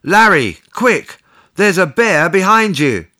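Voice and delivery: high, urgent mock yell; yelled warning. A narrator's reading. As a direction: call out a sudden warning, pitched high. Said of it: "I yelled".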